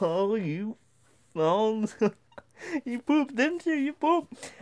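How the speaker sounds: high, teasing mock accusation; teasing sing-song. Two boys accusing each other, high teasing voice. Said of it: "we both turn our heads like".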